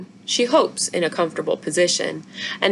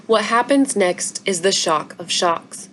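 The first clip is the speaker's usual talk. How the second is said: usual speaking voice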